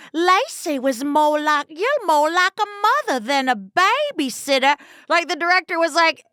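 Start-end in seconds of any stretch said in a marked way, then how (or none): 0.1-4.8 hammed-up Southern belle accent; Gone with the Wind accent. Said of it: "most Gone with the Wind accents".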